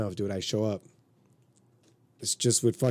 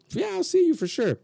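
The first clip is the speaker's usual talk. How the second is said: much higher, mock-eager promise; joking agreement; 3 dB louder than usual. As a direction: play along in a high, unserious voice. Retells his joking drunken promise.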